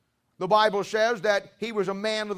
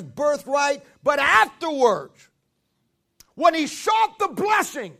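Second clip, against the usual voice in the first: fiery preaching; 4 semitones higher, 5 dB louder than usual. Acted. Preaching builds to shouts.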